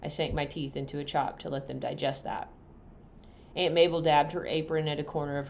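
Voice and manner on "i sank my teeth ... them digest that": usual speaking voice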